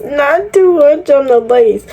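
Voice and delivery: sing-song scolding of her own wig; high mock-stern warning. Shown as a demonstration. Hair vlogger to viewers, mock-stern high warning. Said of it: "repeat of the warning".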